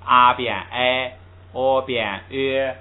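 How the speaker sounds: "啊变哎，哦变ö" each vowel drawn out slowly, sound by sound; slow vowel demo. Shown as a demonstration. Teacher showing students the vowel change, slow and drawn out. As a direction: stretch each vowel so students hear "啊" turn to "哎". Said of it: "就按那个规则来".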